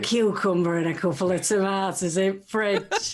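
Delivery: mock-seductive northern English accent. [name] continuing the sexy voice bit, broad northern. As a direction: play it broad northern, dropping the 'the'. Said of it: "you know a sexy northern voice".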